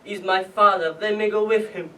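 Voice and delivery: acted desperate pleading, rising. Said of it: "I started to plead".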